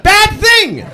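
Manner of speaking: very high, loud shouted warning; panicked yell. Retold or imitated. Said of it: "I just yelled out".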